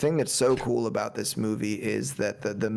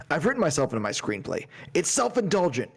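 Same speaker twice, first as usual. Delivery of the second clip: building self-critical rant; 9 semitones higher than usual. Retold or imitated. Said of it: "the thing about this line".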